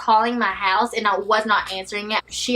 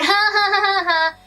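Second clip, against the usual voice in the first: higher mock teasing ha ha; taunting laugh; 8 semitones higher than usual. Girlfriend imitating boyfriend taunting her over the phone, mock laugh. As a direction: imitate his mocking laugh lightly. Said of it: "He is teasing me and taunting me".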